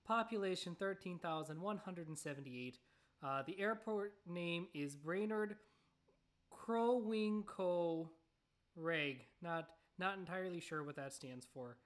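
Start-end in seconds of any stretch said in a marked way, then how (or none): 0.1-9.3 airport entry read in a light, louder Minnesota accent. Shown as a demonstration. Host reading airport facts aloud, in a light Minnesota accent. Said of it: "I'm gonna subject y'all to the accent".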